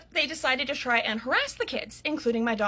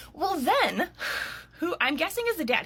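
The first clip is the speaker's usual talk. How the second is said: usual speaking voice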